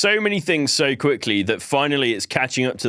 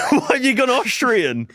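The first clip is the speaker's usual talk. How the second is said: laughing; 10 semitones higher than usual. Real, not acted. Laughing at the failed accent.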